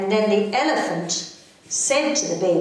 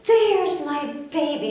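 relieved mother; 8 semitones higher, slower than usual. Acted. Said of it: "so happy".

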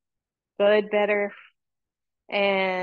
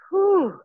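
high whew; 9 semitones higher, 5 dB louder than usual. Real, not acted. Effort during core work.

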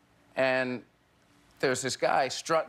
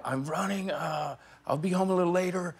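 higher, puffing mid-jog; acted jogging breathlessness; 4 semitones higher than usual. Jogging husband phones his wife, mock out of breath. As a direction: imitate talking while out for a run. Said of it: "So I'm jogging. I call the wife".

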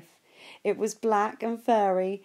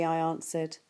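ordinary delivery, nothing marked

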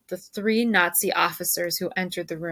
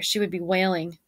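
plain reading voice

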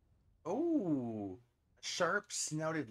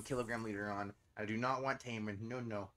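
normal talking voice

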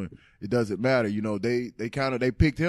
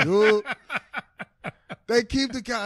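laughing, pitched higher; 10 semitones higher, 3 dB louder than usual. Real, not acted. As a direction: laugh at your own bad luck. Laughing at his missed tackle.

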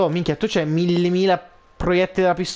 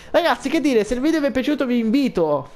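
higher, upbeat sign-off; youtuber outro; 8 semitones higher than usual. Acted. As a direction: wrap up the video for fans. Outro to viewers.

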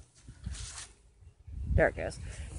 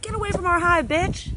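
higher, mock-scolding voice of the bees; mock angry bees; 10 semitones higher than usual. Bees telling the beekeeper to leave, higher mock scolding. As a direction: play the angry bees shooing him off. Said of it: "They're like".

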